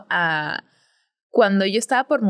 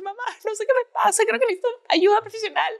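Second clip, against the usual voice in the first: much higher, pleading and helpless; high-pitched plea to mom; 12 semitones higher than usual. Retold or imitated. Host retelling her plea to mom, high and helpless. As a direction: squeak it out like a lost daughter. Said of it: "Y yo era como que".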